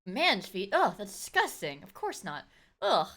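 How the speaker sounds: lightly voiced disgusted woman; mock disgusted officer. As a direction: play her prim and grossed out. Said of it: "She's like".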